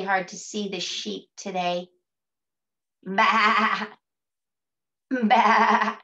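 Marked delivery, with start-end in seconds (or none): 3.0-3.9 loud, wavering "baa"; sheep bleat. Shown as a demonstration. Teacher showing everybody the sheep, sheep bleat. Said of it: "This is a sheep". 5.1-6.0 loud quavering sheep "baa"; sheep bleat. Shown as a demonstration. Teacher introducing the sheep to children, sheep bleat. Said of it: "This is a sheep".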